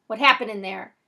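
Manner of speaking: loud urgent question; yelling into phone. A narrator's reading. Man on the phone yells at the boy, loud demand. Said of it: "The man on the phone yells".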